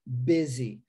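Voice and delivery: Busy said bizzy, low and careful. Shown as a demonstration. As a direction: model Busy with a Z sound. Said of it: "S com a pronúncia de Z".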